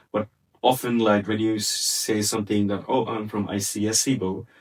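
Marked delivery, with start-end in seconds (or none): none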